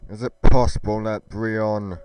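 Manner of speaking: plain reading voice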